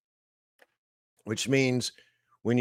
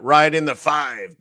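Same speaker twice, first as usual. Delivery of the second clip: called out higher and louder; old trading-floor catchphrase; 5 semitones higher, 6 dB louder than usual. Retold or imitated. Trader recalling an old floor cheer, called out loud. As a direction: belt it like a pit trader's cheer. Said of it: "we used to call that".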